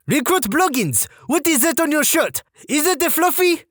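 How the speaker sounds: high French-Canadian drill sergeant; French-Canadian accent. Retold or imitated. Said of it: "female French-Canadian sergeant with this accent".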